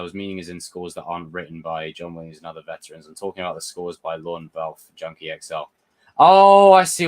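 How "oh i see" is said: high, loud "oh" of sudden understanding; sudden realisation. Real, not acted. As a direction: blurt it out as it suddenly clicks. Reads a viewer's comment, realises.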